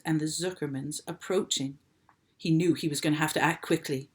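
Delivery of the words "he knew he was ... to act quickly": plain reading voice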